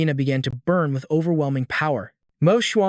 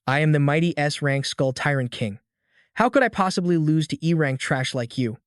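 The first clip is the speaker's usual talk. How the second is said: ordinary delivery, nothing marked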